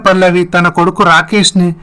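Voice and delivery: ordinary delivery, nothing marked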